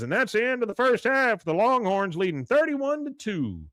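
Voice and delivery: high, loud mock announcer call; stadium announcer. Announcer calls the halftime score, imitated stadium announcer. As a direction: call the score out, drawing out the ending. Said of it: "then the announcer says".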